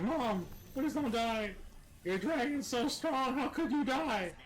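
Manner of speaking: high, slow, mock-weepy little girl voice; pleading like a sobbing child, hammed up; crying little girl. Retelling the game scene, mock crying little girl. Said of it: "They're like".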